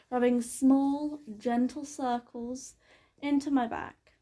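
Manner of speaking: ordinary delivery, nothing marked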